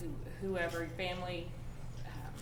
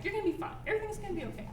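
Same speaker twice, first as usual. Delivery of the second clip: much higher, brightly chirpy fine-it's-fine; high cheery reassurance; 10 semitones higher, much faster than usual. Retold or imitated. Speaker mimicking her own forced cheer, high and chirpy. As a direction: pile on cheer to wave sadness away. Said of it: "which is how I".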